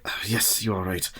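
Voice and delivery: hushed whisper beside a sleeper. Acted. Professor whispering over sleeping Mina. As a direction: keep it hushed so she sleeps on.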